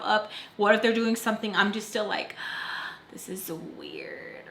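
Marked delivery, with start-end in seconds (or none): 2.4-2.9 quick hushed gasp; small sharp intake of breath; acted gasp. Reacting while hiding in the back. 3.1-4.5 very soft, slow whisper; secretive, drawn-out undertone; hushed aside. Acted. Hidden in back seat, thinking aloud.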